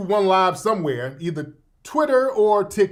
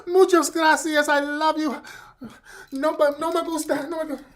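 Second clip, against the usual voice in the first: Zoe Saldana; 7 semitones higher than usual. Retold or imitated. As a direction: gush thanks in a mock Spanish accent.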